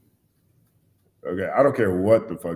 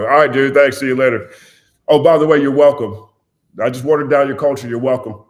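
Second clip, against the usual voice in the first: loud, smug fake gratitude; sarcastic mock thanks; 4 semitones higher, 8 dB louder than usual. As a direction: gloat like a smug song thief. Mocking a culture-vulture artist.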